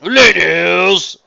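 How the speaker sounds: booming, drawn-out theatrical hail; grandiose voice. A narrator's reading. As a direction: greet them grandly at full volume. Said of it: "came a grandiose voice".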